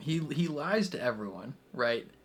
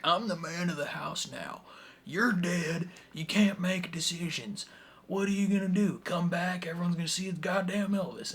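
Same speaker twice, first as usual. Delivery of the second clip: mildly heated mock defiance, higher pitched; mock challenge; 5 semitones higher than usual. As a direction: play a son challenging his father. Acting out the son's challenge.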